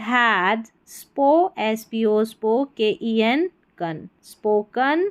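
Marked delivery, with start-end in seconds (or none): none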